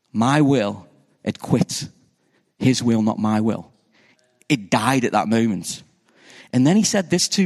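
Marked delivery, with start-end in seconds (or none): none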